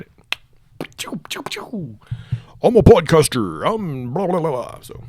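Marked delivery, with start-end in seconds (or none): 1.0-2.0 mock laser pew sounds. 2.6-4.8 mock podcaster. Mocking podcaster persona.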